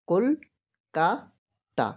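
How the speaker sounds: কল-কা-তা stretched into three slow beats, কল then কা then তা; syllable by syllable. Shown as a demonstration. Teacher showing students how to count syllables, slowly split. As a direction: say কল-কা-তা slowly, a clear break after each syllable. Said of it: "তিনটে ঝোঁক হচ্ছে".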